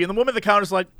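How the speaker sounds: normal talking voice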